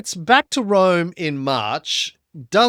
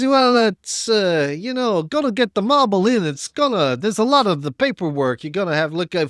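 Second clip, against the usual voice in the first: put-on higher, sly Italian wiseguy accent; 3 semitones higher than usual. Customs guard hinting at a bribe, Italian wiseguy accent. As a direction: lean in like a crooked official. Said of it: "and he goes".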